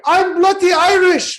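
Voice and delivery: high, loud hammed-up Irish accent, mocking Sheamus; sneering put-on Irish accent. Mocking Sheamus backstage in a loud Irish accent. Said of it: "whatever the fuck an accent is".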